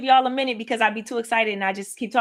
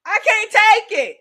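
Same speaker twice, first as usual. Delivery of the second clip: overwhelmed exclamation; 8 semitones higher, 8 dB louder than usual. Retold or imitated. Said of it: "I said".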